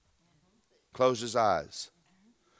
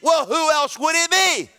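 high, loud, drawn-out shout; exasperated shout; 13 semitones higher, 7 dB louder than usual. Acted. Preacher answering the congregation's doubt, high exasperated shout. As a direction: throw it out as a slow, high holler.

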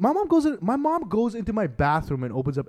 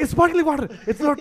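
higher, hurried mom voice; mom's panicky defense; 13 semitones higher, 6 dB louder than usual. Retold or imitated. Mother cutting in to defend her son, in a panicked higher voice. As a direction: jump in as a flustered mother, higher.

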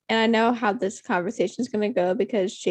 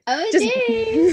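high, slow, drawn-out mock squeal; mock cringy OMG; 12 semitones higher, 3 dB louder, slower than usual. Shown as a demonstration. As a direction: ham up the cringe, high and stretched. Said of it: "That was so cringy".

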